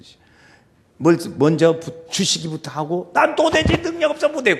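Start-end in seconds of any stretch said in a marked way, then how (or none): 3.1-4.6 high, loud objection; hammed-up reluctant refusal; acted protest. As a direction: protest helplessly in a raised voice. Acts out an unwilling servant protesting.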